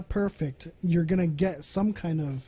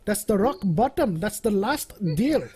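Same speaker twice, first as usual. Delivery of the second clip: put-on higher Indian-accent haggler; 4 semitones higher, 5 dB louder than usual. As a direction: drive a hard bargain in a mock Indian accent. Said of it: "thing to say in an Indian accent".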